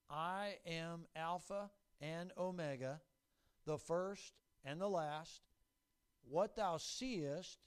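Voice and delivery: slow, weighty scripture declamation; solemn proclamation. Acted. Preacher reads out Jesus' words to church, slow proclamation. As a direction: proclaim it slowly, word by word.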